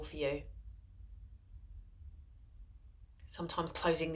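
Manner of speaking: soft, unhurried voice with long pauses; guided meditation. Acted. Mindfulness teacher guiding followers into a seated body scan, soft guided meditation. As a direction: settle listeners gently into stillness, softly and spaciously.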